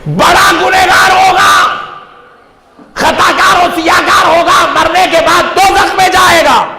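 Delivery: high, shouted denunciation; full-force sermon warning, pitched high; fiery preaching. Real, not acted. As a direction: cry it out to the whole congregation.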